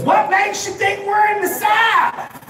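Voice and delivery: high, slow mock radio host; radio lady. Quoting the radio lady, high and slow. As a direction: play the puzzled local host. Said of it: "the lady goes".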